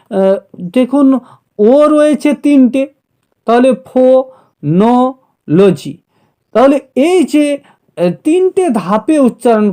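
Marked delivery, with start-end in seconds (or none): 3.9-5.9 ফোনোলজি slowly split as ফো, নো, লজি with pauses; syllable by syllable. Shown as a demonstration. Teacher showing the three vowel steps, syllable by syllable. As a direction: break ফোনোলজি into three separate beats. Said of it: "কত ধাপে একটা শব্দকে উচ্চারণ".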